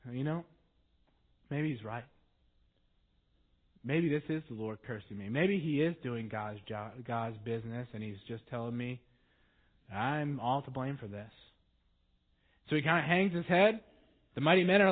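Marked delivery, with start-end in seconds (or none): 0.1-11.3 much lower and softer, defeated; resigned. Retold or imitated. David answering his men in defeat, low and resigned. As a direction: hang your head and let it go. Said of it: "he pretty much shrugs his shoulders".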